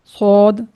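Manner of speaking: सौद thick, lips not rounded; unrounded heavy sod. Shown as a demonstration. Teacher demonstrates keeping lips unrounded, heavy सौद. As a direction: say सौद full, without a w. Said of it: "वो गोल नहीं होंगे".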